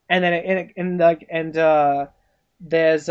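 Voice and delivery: normal talking voice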